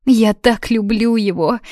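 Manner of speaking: lamenting. A narrator's reading. Даниза weeps over Алан, lamenting. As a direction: lament it through put-on tears. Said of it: "причитала она".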